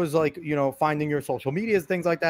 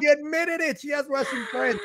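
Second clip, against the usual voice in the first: high-pitched mock outrage, playing the accuser; mock accusation; 9 semitones higher than usual. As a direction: tease with a high, gossipy "gotcha". Mimics accusers pouncing on her admission.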